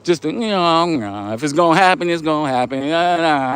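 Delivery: exaggerated lazy, whatever-happens voice; laissez-faire attitude. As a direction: act out someone drifting along, words stretched out lazily. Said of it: "just with a laissez-faire attitude".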